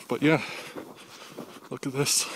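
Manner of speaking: breathy and winded; out of breath. Real, not acted. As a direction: still panting from the snow walk. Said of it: "Sorry about the breathing".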